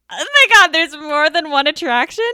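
loud, high-pitched acted disbelief; mock astonishment. Scared visitor learns of a second attraction, loud mock shock. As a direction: re-enact a startled outburst. Reacting to more free tickets.

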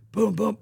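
two high popped booms; imitated gunshots. Actor fires the new gun, imitated gunshots. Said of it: "he goes up and he goes".

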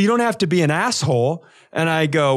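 ordinary talk

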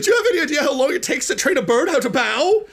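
high-pitched indignant character voice; comic high-voiced outrage; exasperated Iggy. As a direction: protest in a high, hammed-up wail. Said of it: "and he's like".

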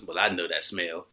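low, sly knowing line; a low smug Chris impression. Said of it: "Chris walks in and goes".

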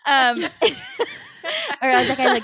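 laughing. Real, not acted. Cracking up at own memory.

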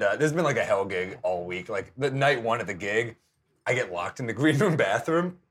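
usual speaking voice

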